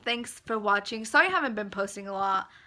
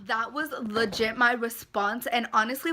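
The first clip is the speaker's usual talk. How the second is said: normal talking voice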